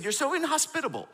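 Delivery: high-pitched mock retort; retold marital spat. Husband answering wife's criticism in the car, mock indignant. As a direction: fire back in a play-acted squabble. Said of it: "in the car having a fight".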